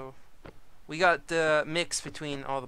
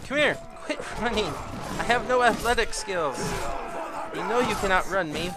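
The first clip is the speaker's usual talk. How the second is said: loud, higher chasing yell; playful yelling; 5 semitones higher, 6 dB louder than usual. Real, not acted. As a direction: holler after the runaway. Chasing fleeing unit.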